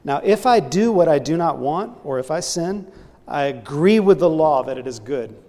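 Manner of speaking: loud, forceful reading of the verse; emphatic scripture reading. Acted. Preacher reads Romans to his church, loud and emphatic. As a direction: proclaim Paul's words to the congregation.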